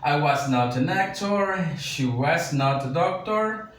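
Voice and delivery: slow, careful classroom read of the English examples; slow, clear teacher read of the sample sentences. Shown as a demonstration. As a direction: read each example slowly for learners to follow. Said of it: "Usamos los ejemplos de la oración afirmativa".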